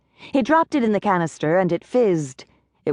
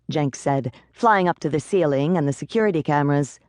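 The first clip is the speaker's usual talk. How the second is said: plain reading voice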